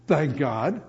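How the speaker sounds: normal talking voice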